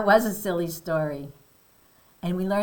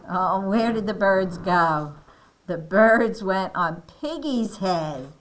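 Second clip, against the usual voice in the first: ordinary delivery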